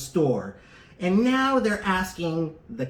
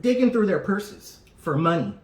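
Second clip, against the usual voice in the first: ordinary talk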